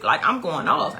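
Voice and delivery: usual speaking voice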